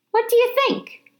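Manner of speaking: ta-da, bragging after a trick; proud showman. Acted. Janitor bowing to the hamster, proud and excited. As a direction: pitch jumps high, showing off.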